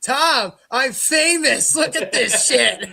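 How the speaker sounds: imitating delighted self-importance; excited boast. Fan showing Tom the hate comments, excitedly.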